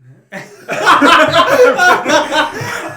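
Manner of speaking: bursting out laughing; big high laugh; loud laugh. Real, not acted. Big laugh at cup remark.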